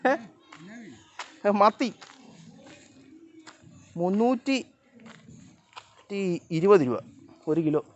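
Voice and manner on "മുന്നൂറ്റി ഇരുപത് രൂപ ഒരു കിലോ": price told with indignation. Real, not acted. Telling the fish price.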